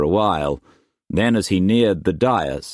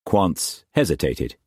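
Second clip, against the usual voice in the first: ordinary delivery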